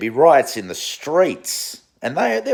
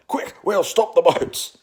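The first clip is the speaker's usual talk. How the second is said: a hint of a flustered minister, slowed; mock panicked politician; 6 semitones higher than usual. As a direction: lightly parody a campaign slogan in a rush. Mocking Liberals at election time.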